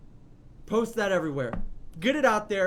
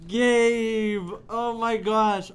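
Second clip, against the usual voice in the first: loud, drawn-out cry of his name; stunned, thrilled exclamation; overwhelmed shout; 3 semitones higher, 7 dB louder, much slower than usual. Real, not acted. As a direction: cry out in delight. Raving after song.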